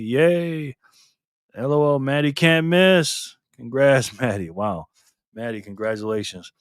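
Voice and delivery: ordinary delivery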